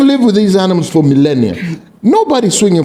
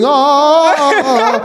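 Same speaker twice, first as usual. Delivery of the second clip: long, higher-pitched Tarzan call; mock Tarzan yell; 5 semitones higher than usual. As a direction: imitate Tarzan's jungle holler.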